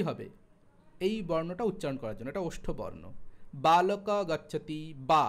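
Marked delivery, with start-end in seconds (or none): 3.7-5.2 slow বালক গচ্ছতি বা with the lips pressed shut on each ব; lip-closing demo. Shown as a demonstration. Teacher showing students both lips join for ব, slow and louder. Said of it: "দুটো ঠোঁট না জুড়ে তুমি উচ্চারণ করতে পারবে না".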